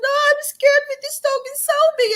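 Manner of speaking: high, loud near-tearful plea; scared, near tears. Retold or imitated. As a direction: beg like you are about to cry. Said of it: "And I almost cry".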